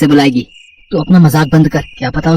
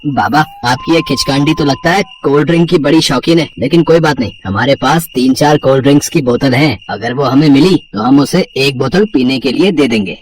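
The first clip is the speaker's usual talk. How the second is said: put-on low, teasing young man's voice; mocking; 7 semitones lower than usual. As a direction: make fun of the old man's warning. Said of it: "उनकी बातों का मजाक उड़ाते हुए".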